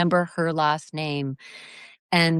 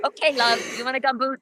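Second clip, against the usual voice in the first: a light Kiwi lilt, higher up, on "Okay, love, you want a gumboot?"; a hint of a New Zealand accent, pitched up; 7 semitones higher than usual. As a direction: imitate a kindly New Zealand woman offering tea. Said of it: "She'd say".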